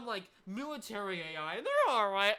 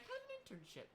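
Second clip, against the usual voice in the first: high, soft, lightly mocked teacher; sweet little teacher imitation; mock cheerful advice, high and quiet; 9 dB quieter than usual. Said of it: "my fucking teacher was like".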